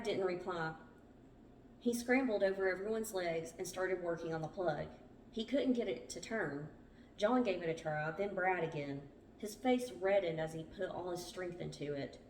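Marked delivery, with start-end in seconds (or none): none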